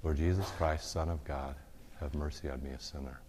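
soft prayer fading to a hush; breath prayer murmured. Shown as a demonstration. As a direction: let each phrase ride a breath out. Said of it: "Or with every breath".

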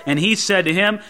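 ordinary delivery

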